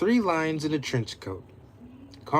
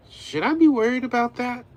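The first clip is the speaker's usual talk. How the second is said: Zoe's worried line; 9 semitones higher, 5 dB louder, faster than usual. Acted. Zoe asks nervously.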